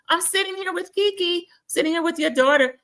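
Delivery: high singled-out phone-greeting voice; imitated Larsa. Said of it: "The Larsa gets on".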